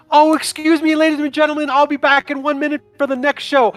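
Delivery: bubbly Selva. Acted. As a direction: greet the crowd like a performer. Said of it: "So she's like".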